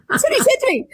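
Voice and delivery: high squealing laughter through the words; cracking up at the memory; laughing hard. Real, not acted. Telling viewers about her husband, laughing high and hard.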